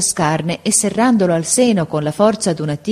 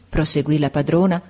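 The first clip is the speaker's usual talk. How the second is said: usual speaking voice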